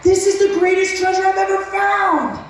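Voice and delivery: high excited exclamation; overjoyed. Retold or imitated. Seeing the garments of praise, high joyful exclamation. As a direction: exclaim it like found treasure.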